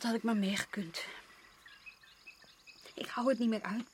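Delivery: whispered, at the end of her rope; despairing whisper. Acted. Wife confesses to husband she can't bear the island, whispered in despair. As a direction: breathe the words out in despair, barely voiced.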